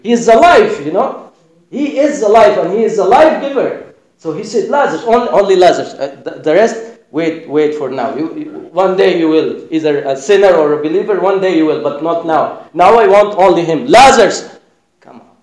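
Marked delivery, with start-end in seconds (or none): 0.0-3.9 higher, louder, fast excited joke; building with delight into a shout; excited preaching. Acted. Someone tells Jesus it is good he named Lazarus, excited and loud. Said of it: "someone said". 4.7-15.4 loud, higher calling voice, strong on Lazarus. Acted. Jesus calls only Lazarus out, loud and commanding.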